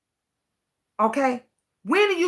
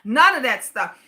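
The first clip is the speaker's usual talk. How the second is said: ordinary delivery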